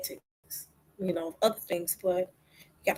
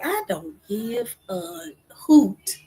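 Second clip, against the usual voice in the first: slow, drawn-out dismissal, higher-pitched; defiant dismissal; 5 semitones higher, 4 dB louder, much slower than usual. Retold or imitated. Preacher answers talk of DNA, defiantly dismissive. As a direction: brush it off, stretching each word. Quotes own defiant reply.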